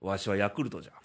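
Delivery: lightly voiced low old man; mock old man. Host announces his fourth-place pick, playful old-man voice.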